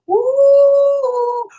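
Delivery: loud high-pitched whoop; excited whoop of mock alarm; high woo. Real, not acted. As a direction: let out a big high whoop. Reacting to the ominous comment.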